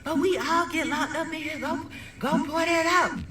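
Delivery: high, urgent commands; animated mother. Retold or imitated. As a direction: order it like a panicked, animated mom. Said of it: "very animated woman".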